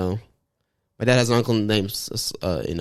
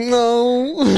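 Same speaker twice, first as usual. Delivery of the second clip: high, squeaky fake-innocent no; a wide-eyed lying no; mock innocent no; 13 semitones higher, 4 dB louder than usual. Lying to the vaccine staff, high innocent squeak. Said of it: "And I'm like".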